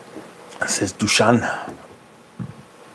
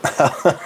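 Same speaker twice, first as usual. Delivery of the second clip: brief leftover chuckle; short amused laugh; 3 semitones higher than usual. Real, not acted. Streamer still tickled by northernisms, chuckling. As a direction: let a little laugh slip out.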